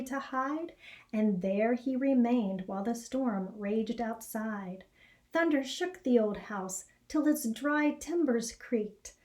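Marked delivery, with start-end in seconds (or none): none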